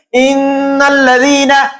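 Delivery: "ইন্নাল্লাযীনা" recited slowly, n hummed and held in the nose; ghunnah. Shown as a demonstration. Presenter shows readers how ghunnah is done, slow nasal held n. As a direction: hold the nasal n in "ইন্নাল্লাযীনা", pausing briefly on it. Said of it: "নাকের ভিতরে বাজায়া একটু থামলাম".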